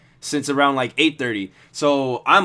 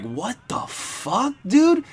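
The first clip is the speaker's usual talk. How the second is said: disbelieving; 8 semitones higher, much slower than usual. Retold or imitated. Reacting in disbelief.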